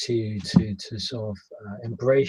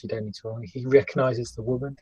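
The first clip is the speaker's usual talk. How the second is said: ordinary talk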